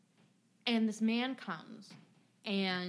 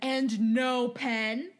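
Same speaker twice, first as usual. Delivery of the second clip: slow, louder, indignant drawl; huffy complaint; 2 semitones higher, 7 dB louder, much slower than usual. Retold or imitated. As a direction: drag out the complaint like an annoyed customer. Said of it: "retold customer complaint".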